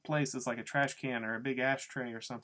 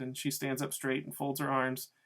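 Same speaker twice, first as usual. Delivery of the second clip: ordinary delivery, nothing marked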